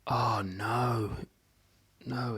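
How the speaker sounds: mildly dismayed dad's "oh no"; retold dismay. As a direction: recall a shocked dad's reaction, lightly. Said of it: "I remember my dad going".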